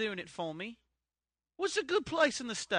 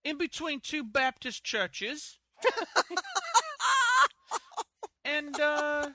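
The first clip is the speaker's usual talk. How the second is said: [name] impression; 5 semitones higher, 3 dB louder than usual. Pitching a home between two churches, lightly campy voice. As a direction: drag out the joke like a flamboyant star. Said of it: "these are the worst impressions ever".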